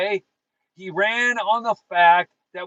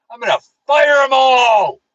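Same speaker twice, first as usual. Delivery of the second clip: shouted quote, louder and higher; mock shout; 5 semitones higher, 8 dB louder than usual. Quoting Trump firing everyone, a hammed-up shout. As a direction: bellow the punchline as Trump firing them. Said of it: "he says".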